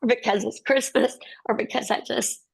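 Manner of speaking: fighting back tears, words tumbling out fast; tearful, emotional. Real, not acted. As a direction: voice rising high and tight with emotion, half-laughing through tears. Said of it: "I'm sorry I'm so emotional".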